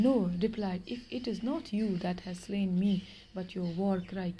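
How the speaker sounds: slow, measured reading of a tale; story reading. Acted. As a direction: read each word out slowly for the class. Teacher reads story aloud. (धीमी आवाज़ में कहानी पढ़ना)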